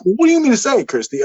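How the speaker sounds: high exasperated what-do-you-mean; incredulous outburst. Retold or imitated. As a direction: pitch jumps way up in disbelief. Exasperated disbelief at friend.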